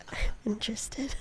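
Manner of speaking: whispered, secretive aside; conspiratorial whisper. Real, not acted. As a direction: lean in and whisper like sharing a secret. Conspiracy topic, teasing aside.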